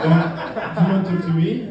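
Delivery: put-on curt French-accented rebuff; goofy French accent, refusing to chat. French kid brushing off a teammate, comic French accent. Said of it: "the French kid".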